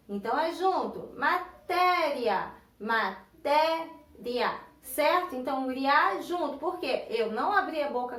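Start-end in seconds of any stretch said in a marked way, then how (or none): 1.2-4.6 "Matéria" very slowly as ma-té-ria; syllable by syllable. Shown as a demonstration. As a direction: stretch "Matéria" into three separate beats. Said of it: "Como eu faço para separar em sílabas?".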